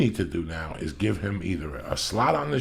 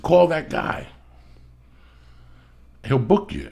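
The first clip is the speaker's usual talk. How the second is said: eager retold advice; 8 semitones higher, 6 dB louder, slower than usual. Retelling urging his buddy.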